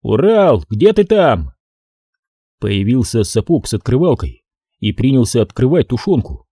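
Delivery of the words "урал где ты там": mild raised call. A narrator's reading. Soldier calling out for missing comrade Ural, mild shout. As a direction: call out for someone out of sight. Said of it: "и крикнул".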